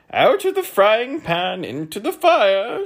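high, drawn-out loud shout. A narrator's reading. Count shouting as he burns himself, high and loud. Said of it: "shouted the count".

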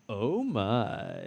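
catchphrase impression, drawn out; George Takei. Naming the new George Takei, then imitating his lingering delivery. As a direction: let "my" glide and linger. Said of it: "He's the new George Takei".

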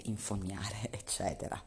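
soft laughing whisper. Real, not acted. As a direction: whisper it with a chuckle.

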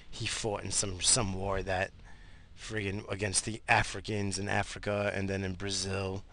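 ordinary talk, nothing marked

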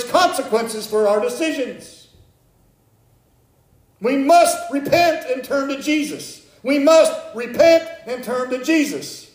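high, loud, urgent sermon voice; full force preaching. Acted. Pastor urging the congregation to repent, preaching at full force. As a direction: preach it out, pleading and warning.

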